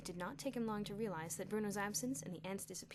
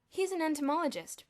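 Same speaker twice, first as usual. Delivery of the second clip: loud, high, grand intoning; intoned; 8 semitones higher, 10 dB louder than usual. A narrator's reading. Bruno explaining Elmer to the boys, intoning. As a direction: announce it gravely. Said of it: "Bruno intoned".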